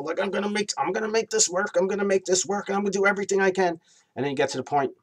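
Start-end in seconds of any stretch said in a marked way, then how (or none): 0.0-3.8 much higher, strained stubborn determination; cling on, forcing it to work; a pleading, high-pitched vow, sped up at the end; desperate insistence. Retold or imitated. Voicing desperate inner thoughts.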